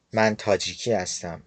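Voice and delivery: normal talking voice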